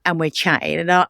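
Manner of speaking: usual speaking voice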